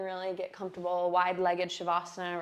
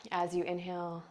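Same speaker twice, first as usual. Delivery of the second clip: guided meditation; 4 dB quieter than usual. Acted. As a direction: breathe the words out softly.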